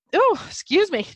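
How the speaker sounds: a big yawn escaping mid-sentence; yawning apology. Real, not acted. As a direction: yawn through the words. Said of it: "just clearing that energy".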